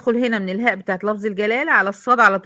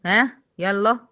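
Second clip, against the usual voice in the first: ordinary talk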